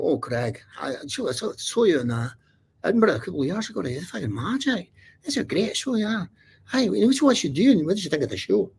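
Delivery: high, lively Scottish voice imitating a fan; Scottish accent. Scottish fan greeting the comedian, in a high Scottish accent. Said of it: "there's a Scottish couple there".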